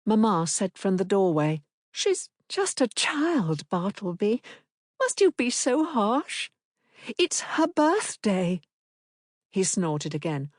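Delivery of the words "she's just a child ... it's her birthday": high, slow gentle woman; pleading mother. A narrator's reading. Wife pleading with Bartleby, slow and high.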